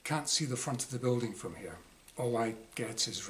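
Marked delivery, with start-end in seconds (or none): none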